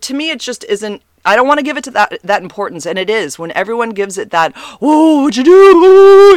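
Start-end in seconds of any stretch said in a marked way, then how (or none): 4.8-6.4 high, loud, slow exaggerated gasp of alarm; mock shock. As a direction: ham up a scandalised "Whoa". Mocking people who overreact.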